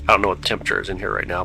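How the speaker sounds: ordinary talk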